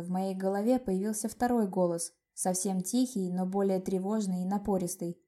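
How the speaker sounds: usual speaking voice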